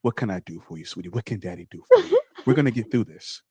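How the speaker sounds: low, tender dad voice; comforting father. Acted. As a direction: reassure your child in a low dad voice. Voicing the father kneeling to comfort.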